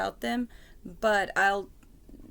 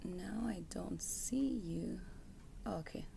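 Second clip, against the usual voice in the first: whispering; 9 dB quieter than usual. Real, not acted. Fumbling with camera setup.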